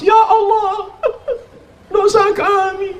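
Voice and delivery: high, wailing sob in prayer; mock crying. Worshipper at group dhikr begging forgiveness, mock crying. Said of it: "mau nangis".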